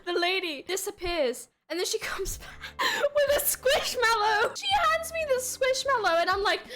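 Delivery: high, squeaky voice on the edge of sobs; mock tearful. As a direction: tell it in a hammed-up crying voice.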